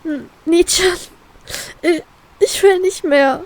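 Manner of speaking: sobbing to her friend, broken. Acted. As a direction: cry out that you can't take it anymore. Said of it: "schluchze ich".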